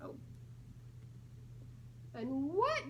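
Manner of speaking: ordinary delivery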